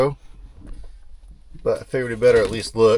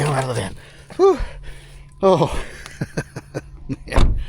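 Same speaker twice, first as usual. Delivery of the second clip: ordinary delivery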